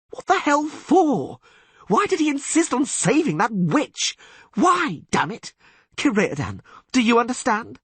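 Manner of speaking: high, loud anguished cry; despairing outburst. Acted. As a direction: rail against it in despair. Dandelion despairing and shouting.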